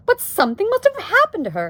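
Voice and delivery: high, loud alarm; exclaiming. A narrator's reading. Wife fears for the missing aunt, exclaiming. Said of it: "exclaimed Homley".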